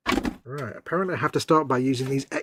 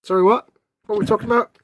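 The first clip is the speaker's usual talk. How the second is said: mock startled; 8 semitones higher, 9 dB louder, faster than usual. Feigned distraction, loud high.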